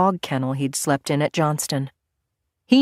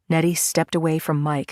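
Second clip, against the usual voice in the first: plain reading voice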